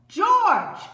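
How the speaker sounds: high, loud call from below. A narrator's reading. The friend calls up to George on the traffic light, loudly. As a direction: call his name across the street.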